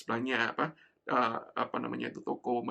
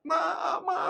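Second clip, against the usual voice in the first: put-on crying child calling; 5 dB louder than usual. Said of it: "Mulai saya mulai menangis".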